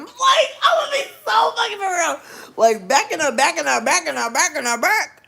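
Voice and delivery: very high, loud screech of insistence; over-the-top yelling. Real, not acted. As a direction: scream it way up high for emphasis. Yells her confession at the audience.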